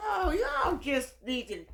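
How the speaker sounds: loud, slow, exasperated; exasperated outburst. Real, not acted. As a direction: throw up your hands at them.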